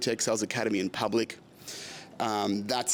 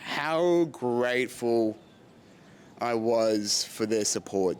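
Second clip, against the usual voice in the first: choked up; slower than usual. Real, not acted. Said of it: "even now I'm getting emotional".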